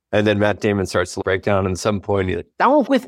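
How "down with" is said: put-on British accent. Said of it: "with his British accent".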